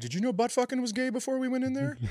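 high, fast mock astonishment; shocked guy. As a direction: play a guy suddenly acting clueless. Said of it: "some guy's like".

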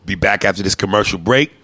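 drawn-out mock announcer voice; announcer read. Host teases next week's show, mock announcer.

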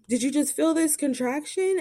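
checking whether she felt it; nurse asking. Retold or imitated.

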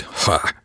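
one breathy, voiceless "ha"; single wretched laugh. A narrator's reading. Woman asked about pressing charges, one wretched laugh. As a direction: let out one bleak puff of a laugh. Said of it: "a single wretched".

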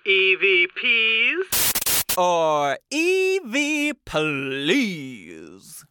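slow, booming spooky announcer; drawn-out eerie segment intro; unearthly voice. Pretend.

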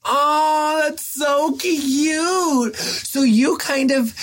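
gushing. Real, not acted. Reacting to pregnancy story.

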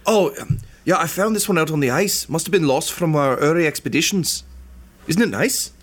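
high put-on gnome voice, eager; gnome NPC voice. Peachtree explaining herself to the party, high and eager. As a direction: answer quickly, a bit too eager.

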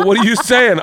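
high, loud mock alarm; shocked dad. Dad asking daughter what she says, shocked. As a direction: demand it in pretend disbelief.

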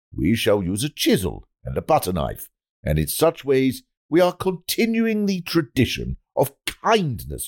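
measured oratory with weighty pauses; pompous politician's speech. Acted. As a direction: declaim it like a minister addressing the nation. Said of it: "politicians make those speeches that go".

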